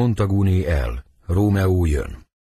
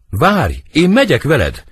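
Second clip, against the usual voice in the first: raised, louder urgent plea; insistent, higher-pitched appeal to wait; urgent call; 6 semitones higher, 4 dB louder than usual. Acted. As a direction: call after a friend who is leaving. Benvolio calls after departing Romeo.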